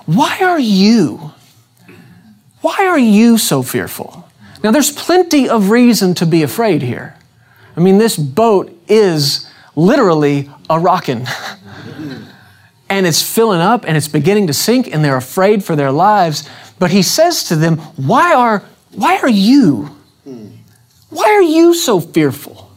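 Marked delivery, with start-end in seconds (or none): 0.0-4.3 slow, tender question; caring tone. Shown as a demonstration. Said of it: "Listen to this". 18.0-22.7 rising higher into an earnest plea on fearful; pleading question. Shown as a demonstration. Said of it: "hear the spirit in what he's saying".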